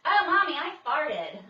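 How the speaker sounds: put-on loud, high five-year-old announcing; proud little boy. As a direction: blurt it out like a proud little kid. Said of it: "will be like".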